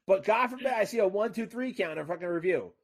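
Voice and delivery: exasperation climbing into a very high yell; indignant, voice shooting up; pissed-off rant. Acted. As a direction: build from loud complaint to high-pitched outrage. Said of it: "My voice gets a little high when I get a little pissed off".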